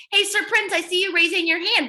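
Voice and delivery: normal talking voice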